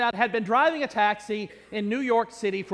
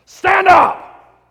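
booming roar, much higher and louder on stand up; roaring shout; 10 semitones higher, 14 dB louder than usual. Acted. Preacher roaring at dozing usher, booming shout. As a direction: blast it out to wake the sleeper. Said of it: "that roaring voice booming through the sanctuary".

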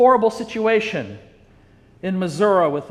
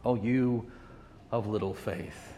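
much softer and lower, almost breathed; hushed tender rebuke; 10 semitones lower, 16 dB quieter than usual. Acted. Preacher repeats Jesus' gentle words to doubting Peter, hushed. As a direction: sigh it out like a loving disappointment.

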